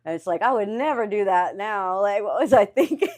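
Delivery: loud, high incredulous exclamation; amused disbelief. Real, not acted. Looking back on homeless days, amused disbelief. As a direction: marvel at your own past craziness. Laughing disbelief at own past choices.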